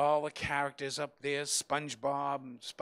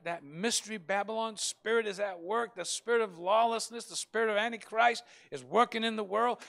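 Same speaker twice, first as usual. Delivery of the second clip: raised, urgent pulpit voice; fiery preaching; 7 semitones higher, 5 dB louder than usual. Acted. Pastor warning the church of persecution, urgent. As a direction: preach it hard to the congregation.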